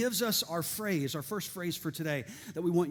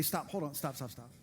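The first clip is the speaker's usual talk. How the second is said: firm self-talk; 6 semitones lower, 9 dB quieter than usual. Shown as a demonstration. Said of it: "You just need to say".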